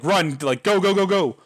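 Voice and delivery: retold urgent shout telling friends to run; urgent yelling. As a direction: hurry them inside, yelling. Urging friends to flee.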